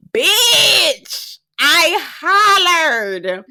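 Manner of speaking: loud high laughing holler. Real, not acted. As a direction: crack up out loud.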